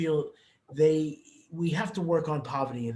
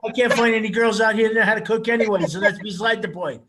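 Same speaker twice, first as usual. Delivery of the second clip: loud joking complaint; 6 semitones higher, 8 dB louder than usual. Real, not acted. Host joking about girls.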